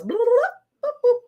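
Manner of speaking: high, playful bloop noises; silly sound effect. Acted. Host filling in giveaway details with high silly bloops. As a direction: pop out each bloop like a cartoon bubble.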